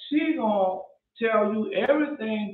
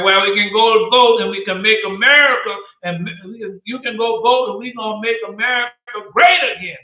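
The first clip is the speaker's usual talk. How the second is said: loud, raised campaign preacher voice; imitating a rally preacher; 3 semitones higher, 8 dB louder than usual. Preacher mocking Hagee's rally speech, loud and raised. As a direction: belt it like a televangelist whipping up votes. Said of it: "John Hagee and Matt Hagee come there and say".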